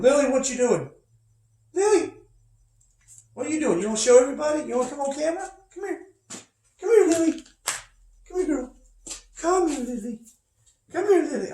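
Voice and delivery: high coaxing voice calling the dog over; sweet, high sing-song to coax the puppy; high-pitched dog call. Real, not acted. Teacher calling his dog Lily to come on camera, high and coaxing.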